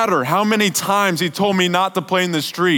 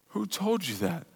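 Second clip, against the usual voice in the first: much softer, near whisper; hushed question; 13 dB quieter than usual. Real, not acted. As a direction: let the question hang softly. Soft echo after gentle God voice.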